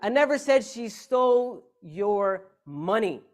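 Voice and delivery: "money" set apart and punched loud; slow down, pause, then hit "money"; deliberate, spaced ending landing hard on "money"; stress on MONEY. Shown as a demonstration. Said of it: "depending on which word you emphasize".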